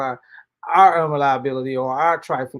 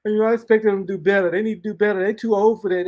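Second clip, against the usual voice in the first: whiny mock griper, pitched higher and louder; mocking complainer; 4 semitones higher, 3 dB louder than usual. Preacher mimics a griper fed up with fools, mocking. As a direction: ham up a fed-up friend who expects more. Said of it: "why you like".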